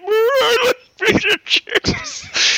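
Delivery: very high, drawn-out hooting parody; imitated mock foreigner. Podcaster retelling the friend's gag, high imitated foreigner voice. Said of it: "But then he went".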